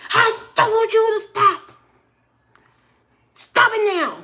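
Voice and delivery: high, sharp mock scolding; an angry mother losing patience with her son; mom snapping. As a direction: snap at the kid, fed up. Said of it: "She snapped real quick".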